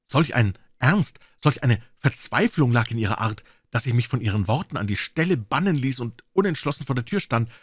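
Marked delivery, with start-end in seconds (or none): none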